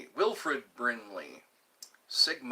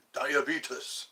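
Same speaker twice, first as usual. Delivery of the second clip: Greek-sounding. Pretend. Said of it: "That sounds Greek".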